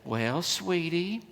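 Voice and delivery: soft, slow, near-whispered tenderness; sweet, compassionate parent. Acted. Good parent soothing a complaining child, sweetly. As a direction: coo it gently to a child, drawn out. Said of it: "you say these words sweetly".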